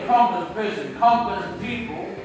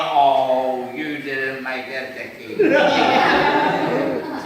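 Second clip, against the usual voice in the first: slow, drawn-out sing-song consolation; inner angel; 4 semitones lower, 4 dB louder, much slower than usual. Acted. Angel lifting him up after the trip, sing-song soothing. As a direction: coo it slowly like a doting angel. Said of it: "my angel will jump in and go".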